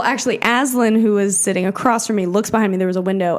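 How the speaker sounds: ordinary delivery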